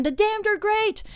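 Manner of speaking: high-pitched imitated fan praise; acted enthusiasm. Led Zeppelin praising the band after drinking, acted enthusiasm. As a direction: voice an impressed rock star's approval.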